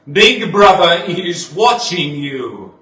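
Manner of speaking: loud, slow, menacing slogan; booming slogan. Acted. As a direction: proclaim it like a broadcast. Slogan repeated, louder and slower.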